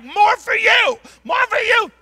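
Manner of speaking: loud, high-pitched call; exuberant shouting. Retold or imitated. Preacher calling to passengers as he walks the aisle, exuberant shout. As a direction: holler it out to everyone along the aisle.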